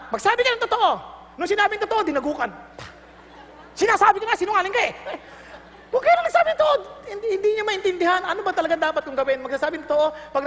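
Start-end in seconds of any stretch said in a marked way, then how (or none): 0.1-0.9 parent's command. Retold or imitated. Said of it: "Kahit sa mga anak". 3.8-4.9 mock scolding. Parent scolding child retold. 5.9-6.8 laughing mock advice. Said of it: "mocking conclusion".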